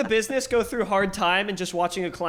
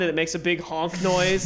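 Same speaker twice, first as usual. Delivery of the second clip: ordinary talk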